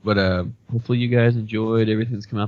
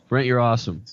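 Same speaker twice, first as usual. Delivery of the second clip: usual speaking voice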